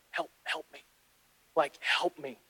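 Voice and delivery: tiny mock plea, barely voiced; hushed plea. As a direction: plead under your breath. Silent plea voiced in story.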